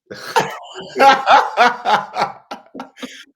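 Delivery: high, loud breathy laugh bursting out; helpless laughing fit; burst of laughter. Real, not acted. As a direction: crack up at the punchline. Laughs at the Baldy punchline.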